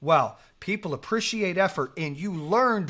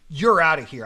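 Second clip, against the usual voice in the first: referee's order, much louder; loud ejection; 10 dB louder than usual. Retold or imitated. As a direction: throw him out with a loud call.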